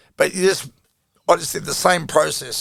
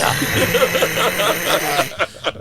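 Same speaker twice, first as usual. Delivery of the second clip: laugh; 5 semitones higher than usual. Real, not acted. Laughing after the punchline.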